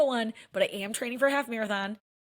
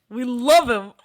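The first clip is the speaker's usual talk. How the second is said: much louder, punching love; emphatic love; 2 semitones higher, 9 dB louder, slower than usual. Real, not acted. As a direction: say We love him with a loud punch on love. Repeat, louder.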